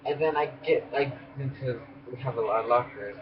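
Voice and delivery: ordinary delivery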